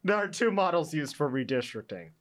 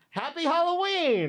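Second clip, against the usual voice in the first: high, cheery holiday shout; bright festive announcer cheer; excited; 12 semitones higher than usual. Real, not acted. Festive radio greeting.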